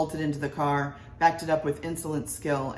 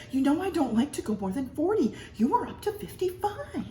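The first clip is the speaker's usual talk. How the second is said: nagging wife; 11 semitones higher than usual. A narrator's reading. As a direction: press the point like a worried wife. Wife nagging, voiced higher.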